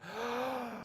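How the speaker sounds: soft, high drawn-in gasp; sharp gasp. Pretend. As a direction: suck in a breath to start the bit. Starts a Tenet-style bit.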